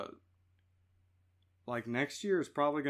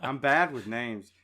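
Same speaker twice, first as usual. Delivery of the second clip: slow, louder, laughing it out; 9 dB louder than usual. Real, not acted. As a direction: laughs while admitting it. Laughing at own name slip.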